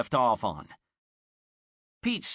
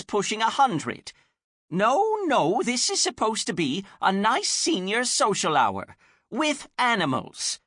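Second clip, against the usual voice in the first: put-on high, fussy old woman; muffled old lady; 9 semitones higher, 4 dB louder than usual. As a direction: plead through the door like a busy granny. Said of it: "says a muffled voice".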